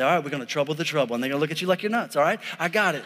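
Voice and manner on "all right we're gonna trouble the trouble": quick aside. Pretend. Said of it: "brings you a little trouble and say".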